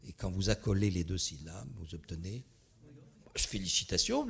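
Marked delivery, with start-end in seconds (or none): none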